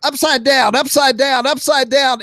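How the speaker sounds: high, urgent chant; frantic repetition. Retold or imitated. Acting out frantic inverted flying.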